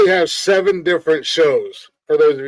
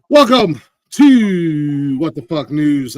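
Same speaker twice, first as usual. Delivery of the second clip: slow drawn-out show intro; announcer voice; 4 dB louder, much slower than usual. Acted. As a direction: stretch the welcome like a TV host. Host announces show name.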